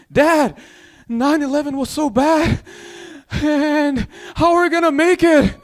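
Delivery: high-pitched, hammed-up plaintive storyteller; Hassan Minhaj. Son asking dad how they'll make it, plaintive. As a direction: overdramatic impression, pitched well up. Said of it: "Hassan Minhaj".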